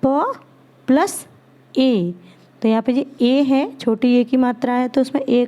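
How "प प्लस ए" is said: प and ए spoken as separate sounds, plus rising between them; sounding out letters. Shown as a demonstration. Teacher to children, splitting पे into sounds. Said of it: "ध्वनि के छोटे टुकड़े देखिए".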